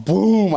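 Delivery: high, falling boom; emphatic storytelling boom; imitated impact. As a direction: punch out a sudden impact noise. Imitating impact against the door.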